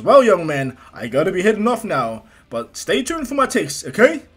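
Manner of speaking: loud, hearty hero sign-off; All Might. Acted. Hero bidding a boy farewell, loud and hearty. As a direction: boom it out, rising high toward the end. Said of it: "All Might smiles and goes".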